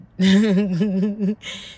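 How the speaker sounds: loud burst of laughter; loud real laughter. Real, not acted. Laughing at own remark.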